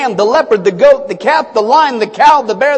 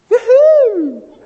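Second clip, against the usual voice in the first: playful yoo-hoo; 10 semitones higher, 4 dB louder than usual. Acted. Excited couple before wedding.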